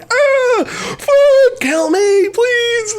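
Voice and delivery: very high, strangled mock cat voice; hammed-up high agonized wail; screaming in pain. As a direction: imitate a cat begging in agony. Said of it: "it's screaming in pain".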